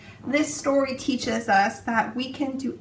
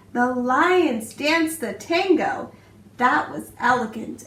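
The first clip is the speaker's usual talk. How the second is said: put-on expressive read-aloud for young children; storybook narration; slower than usual. A narrator's reading. Teacher reading a picture book to her class, expressive storytelling. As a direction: tell it like bedtime story time, rhythmic and lively.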